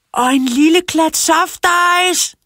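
high, loud cheeky yell; shouted very loudly. A narrator's reading. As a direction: yell high to top the other kids. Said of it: "råbte Tobias endnu højere".

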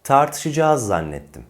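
"Tartışacağız" contracted to tar-tı-şı-caaz; long a, no ı glide in "Tartışacağız"; correct spoken form. Shown as a demonstration. Teacher models a sentence, "Tartışacağız" as tartışıcaaz. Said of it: "Doğru telaffuz bu".

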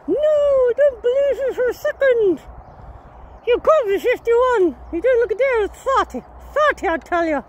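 high put-on voice of gushing disbelief; mock flattering admirer. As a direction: play a flatterer protesting in a high voice.

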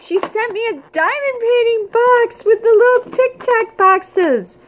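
delighted shriek. Real, not acted. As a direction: shriek and gush over the present. Finds diamond painting box.

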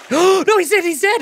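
high, alarmed cry that he's dead; excited cry. Real, not acted. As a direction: player shocked as the man dies, crying out high. Game character just died.

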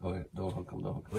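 hushed. Real, not acted. Hushed urgent plea.

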